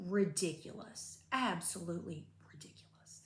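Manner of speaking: whispered disbelief. Real, not acted. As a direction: breathe it out as a private aside. Aside on the hospital discharge.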